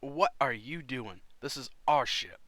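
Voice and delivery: loud, indignant challenge; affronted armored stranger. Acted. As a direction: confront intruders on your ship. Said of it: "looks at you and goes".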